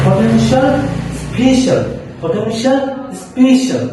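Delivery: high list, পটেনশিয়াল rising and স্পেশাল falling; pronunciation drill. Shown as a demonstration. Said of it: "আচ্ছা, দেখেন". (উঁচু স্বরে পটেনশিয়াল, স্পেশাল অনুশীলন)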